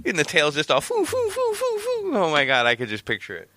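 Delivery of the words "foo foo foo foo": high whooshing foo sounds; tail-wag noise. Retold or imitated. Picturing the flying dog's tail, high whooshing foo sounds. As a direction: make the tail swish noise.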